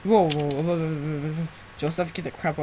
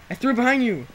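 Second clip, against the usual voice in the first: raised, higher, exasperated; exasperated calling; 7 semitones higher, 7 dB louder than usual. Real, not acted. Player directing friend to dropped items, exasperated call. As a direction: call it out louder, losing patience.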